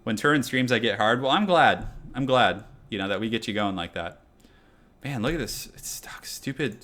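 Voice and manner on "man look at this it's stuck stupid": whispered, under the breath; annoyed whisper. Real, not acted. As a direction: hiss it to yourself, fed up. Muttering at stuck loading screen.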